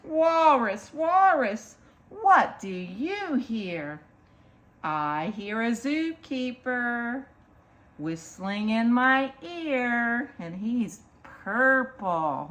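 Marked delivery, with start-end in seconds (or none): 0.0-10.3 slow, lilting picture-book read; reading to children. Acted. Storyteller reads a picture book to children, lilting. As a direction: read it rhythmically, stretching the key animal words.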